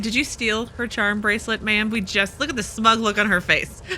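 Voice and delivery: ordinary talk, nothing marked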